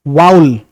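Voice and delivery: वाउल with a clear au glide; vowel said as waul. Shown as a demonstration. Teacher saying वाउल as "waul". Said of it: "असल में वाउल ही होता है".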